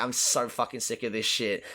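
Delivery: low, muttered and fed up; character losing patience, voiced low and flat; mock fed-up. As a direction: grumble it like a man at his limit. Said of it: "in his eyes, it's just like".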